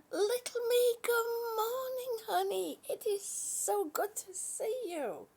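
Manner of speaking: very high, squealy sweet greeting voice; sing-song greeting. Acted. Greeting a chat viewer Lee, high and gushing. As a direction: gush a hello up high.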